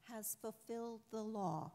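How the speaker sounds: normal talking voice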